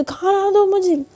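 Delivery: high, slow whiny demand; childish demand. Acted. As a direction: whine it up high like a spoilt child. Drunk girl demanding food. (ऊँची, धीमी, ज़िद्दी बच्चे जैसी माँग)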